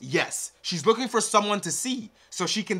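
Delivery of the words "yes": normal talking voice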